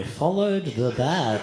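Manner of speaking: mock-profound slow confession; spaced-out solemn answer. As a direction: draw each word out slowly, dazed and grave. Spaced-out answer for guest book.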